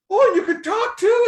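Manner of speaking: very high, loud squeal of wonder; loud retold exclamation of delight; amazed delight. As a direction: re-enact astonished joy, pitch soaring. Retold amazement that baby talks.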